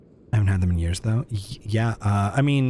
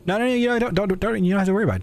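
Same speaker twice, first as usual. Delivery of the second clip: high mock reassurance; 11 semitones higher, much faster than usual.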